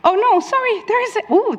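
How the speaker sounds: high-pitched, caught-out apology; flustered surprise. Real, not acted. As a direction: blurt it high, surprised by your own mistake. Caught out by more slides.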